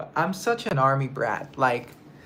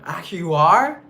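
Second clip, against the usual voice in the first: surprised; 4 semitones higher, 11 dB louder than usual. Real, not acted. Surprised by his answer.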